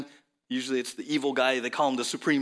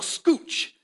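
retold yell; 14 semitones higher, 5 dB louder than usual. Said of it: "And I'm gonna yell".

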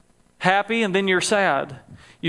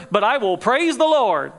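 raised, swelling declaration of praise; uplifted praise; 4 semitones higher than usual. Retold or imitated. Preacher voicing David's turn from discouragement, in uplifted praise. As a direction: declare it with lifted pitch, as a psalmist.

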